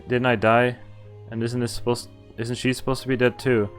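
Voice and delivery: ordinary talk, nothing marked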